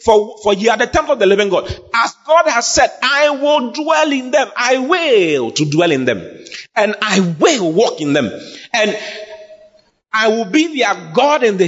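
high declamation, stretching key words; impassioned scripture preaching. Real, not acted. Preacher declares God's promise to dwell in believers, declamatory.

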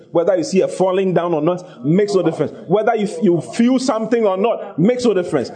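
high, forceful preaching; fiery preaching. Acted. Preacher rousing the congregation, fiery preaching. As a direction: build the congregation up.

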